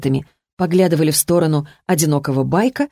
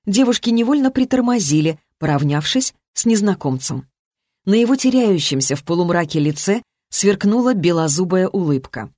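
ordinary delivery, nothing marked

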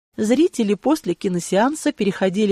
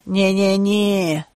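put-on slow drunken no-no-no; tipsy head-shaking protest; drunk refusal; much slower than usual. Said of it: "Хозяин пьяно замотал головой".

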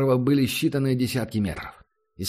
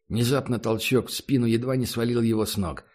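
plain reading voice

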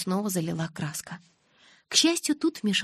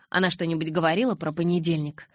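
ordinary delivery

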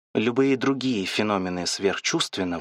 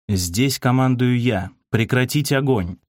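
higher, slow, emphatic command; commanding shout; 4 semitones higher than usual. A narrator's reading. Sergeant stops a volunteer about to fire, commanding shout. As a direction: assert authority with a restrained narrated shout. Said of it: "и прикрикнул".

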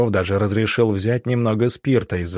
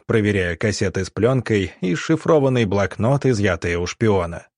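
plain reading voice

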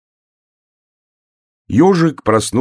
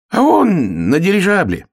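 high, loud airy boast; showily casual; 12 semitones higher, 5 dB louder than usual. Acted. Hare boasts of his dog's journey, casually. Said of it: "небрежно сказал заяц".

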